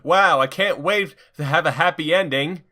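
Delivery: high, eager hero; giddy at the prospect; excited Sonic. Acted. Sonic thrilled by the spa offer, eager.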